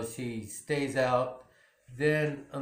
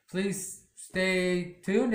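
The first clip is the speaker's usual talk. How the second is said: drawn-out sign-off; 4 semitones higher, much slower than usual. Real, not acted. Vlog sign-off.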